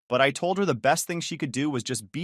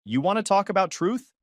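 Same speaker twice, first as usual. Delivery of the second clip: raised pitch, angry challenge; shaking with rage; 8 semitones higher than usual. Acted. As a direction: throw the word truth back at her in fury. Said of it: "I'm shaking with rage now".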